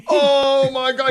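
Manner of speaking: high, loud, drawn-out mock exclamation; mock amazement. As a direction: imitate an amused teen, slow and high. Retelling son's amused reaction.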